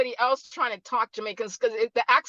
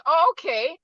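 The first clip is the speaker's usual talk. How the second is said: higher, surprised "oh" of realisation; mock surprise; 6 semitones higher than usual. Phone contacts realising who she is, imitated surprise. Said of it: "in surprise, they're thinking".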